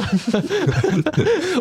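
sudden high cackle at her own story; high laugh. Real, not acted. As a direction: burst of high-pitched laughter.

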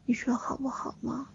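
put-on soft childish begging whisper; cutesy whiny pleading. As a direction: wheedle like a spoiled little kid. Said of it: "撒娇".

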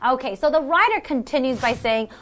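ordinary talk, nothing marked

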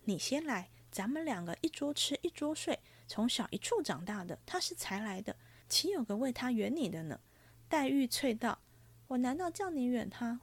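lively storybook reading with voiced characters; story narration. A narrator's reading. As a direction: read the chapter like a storyteller. Reads novel chapter aloud, higher.

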